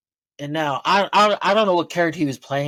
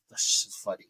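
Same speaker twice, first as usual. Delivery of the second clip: whispered thought; 5 semitones lower, 6 dB quieter than usual. Retold or imitated. Quietly voiced inner thought.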